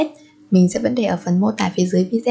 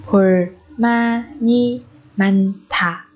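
"Bulmani manta" very slowly, syllable by syllable; teacher's drawn-out model of "Bulmani manta"; 3 semitones lower, much slower than usual. Shown as a demonstration. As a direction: pause between each syllable of "Bulmani manta". Teacher repeats phrase syllable by syllable.

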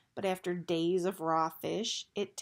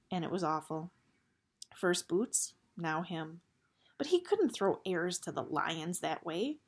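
plain reading voice

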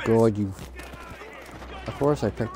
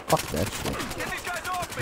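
very high, slow, soft squeaky voice; mock squeal; 6 dB quieter, much slower than usual. Real, not acted. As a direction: squeak it out in disgust. Frustrated by enemies.

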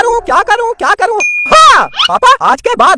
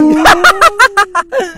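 witch cackle. Acted. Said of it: "क्या acting करती हो मम्मी".

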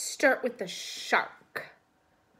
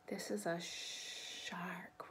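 secret whisper; 4 semitones lower, 15 dB quieter, much slower than usual. Acted. Suspense reveal to children.

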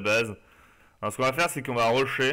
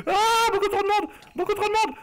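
high panicked wail, much higher; 4 dB louder than usual. Real, not acted. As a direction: wail as the mob swarms in. Swarmed by mobs.

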